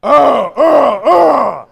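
three loud grunts; loud demo grunts; group grunts. Shown as a demonstration. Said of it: "Let's do it".

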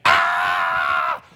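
sudden loud anguished yell; short pained scream; imitated scream of pain. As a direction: cry out like a dying man in pain. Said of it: "he's just screaming".

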